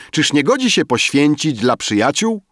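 high, loud retort; exclaiming. A narrator's reading. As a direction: exclaim it back at your friend. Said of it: "wykrzyknął poeta". (głośny, wysoki okrzyk poety)

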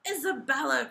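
loud, high call of the name; forceful royal summons; crying out. A narrator's reading. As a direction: cry out like an exasperated king. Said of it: "cried the king".

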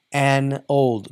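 ordinary delivery

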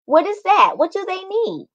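put-on young girl voice. A narrator's reading.

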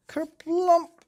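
high, loud comic kerplump; crash-landing thud. A narrator's reading. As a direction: boom out the clumsy landing.

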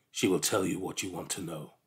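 low, softly voiced beast; a hint of an otherworldly creature; eerie creature. A narrator's reading. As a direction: answer low and soft, as a strange creature. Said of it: "replied the creature".

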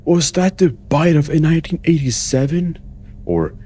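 high, loud mock-shocked question; hammed-up outburst. Player quoting a catchphrase at the game, hammed-up outburst. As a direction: blurt it high and loud, overdramatic.

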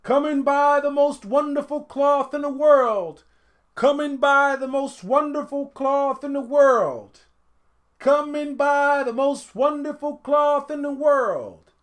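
loud market calls, pitched high; calling out. A narrator's reading. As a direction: cry out to the crowd like street sellers. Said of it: "called out".

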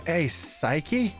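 ordinary delivery

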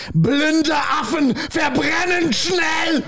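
high, drawn-out German shout; mock German screaming. Host shouting a quiz phrase, slow mock German scream. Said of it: "you're just screaming".

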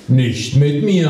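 ordinary delivery

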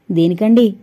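ordinary delivery

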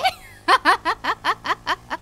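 high, loud bursts of laughing; relieved laughter. Real, not acted. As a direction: burst out laughing after a scare. Laughs after jump scare.

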